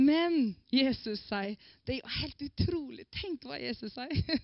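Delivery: normal talking voice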